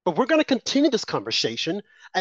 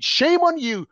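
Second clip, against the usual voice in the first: indignant rebuke; 10 semitones higher than usual. Real, not acted. Caller rebuking celebrities, high outraged scolding. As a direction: pitch jumps high on "on" in an outraged "Shame on you". Denouncing public figures one by one.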